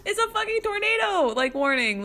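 half-laugh, half-cry at the absurdity; laughing disbelief. Real, not acted. Incredulous at neighbor's calm.

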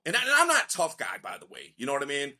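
ordinary talk

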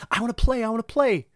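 high, eager puppy voice; excited puppy. Acted. Puppy pestering a kid at relaxing time, excited. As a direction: yap it like a puppy begging for a game. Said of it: "Dude, it's a puppy. It's like".

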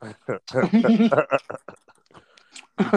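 laughing high and giddy. Real, not acted. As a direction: giggle through the words. Laughing at trademark joke.

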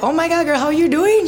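high, gushing hello; excited greeting. Retold or imitated. Meeting Lola at Walmart checkout, excited greeting. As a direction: greet an old friend with delight.

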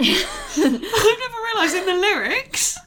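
high, slow words through laughter; laughing. Real, not acted. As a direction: laugh while you say it.

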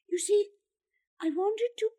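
high-pitched, hesitant explanation; halting, unfinished confession; faltering. A narrator's reading. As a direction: falter and leave it hanging. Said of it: "Gerard faltered".

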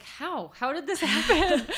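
high, loud, laughing disbelief; laughing amazement. Retold or imitated. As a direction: marvel out loud through a laugh. Amazed at friend's social kids.

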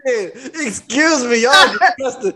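ordinary talk

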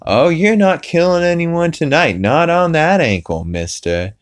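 high-pitched scolding wife voice; Lois. Retold or imitated. Lois forbids Death killing anyone tonight, scolding wife. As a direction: put your foot down as a fussing cartoon wife. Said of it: "I love Lois's voice".

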